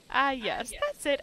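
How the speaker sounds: ordinary talk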